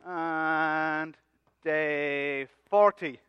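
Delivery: very slow, stretched-out time card; slow, drawn-out scene announcement. Acted. Announcing time jump in skit.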